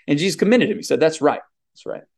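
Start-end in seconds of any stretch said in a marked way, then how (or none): 1.7-2.0 quiet echo. Retold or imitated. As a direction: repeat "That's right" much softer, almost under the breath. Jesus commends Peter.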